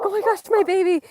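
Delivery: high, loud imitation of a scared dog mom; mock panic. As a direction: fret over your baby in mock alarm. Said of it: "I always get so scared when".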